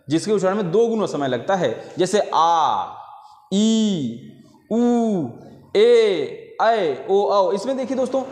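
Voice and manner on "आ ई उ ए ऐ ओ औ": आ ई उ ए ऐ ओ औ each held twice as long; long vowels आ ई उ ए ऐ ओ औ sung out for the class; drawn-out long vowels. Shown as a demonstration. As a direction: stretch every vowel आ, ई, उ, ए, ऐ, ओ, औ out slowly, like a teacher. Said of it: "इसमें दो गुना समय लग रहा है".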